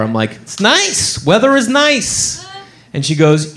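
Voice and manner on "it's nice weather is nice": high, drawn-out mock-casual answer; nervous fib. Man faking New York weather to caller, high nervous fib. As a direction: lie in a high, hammed-up voice.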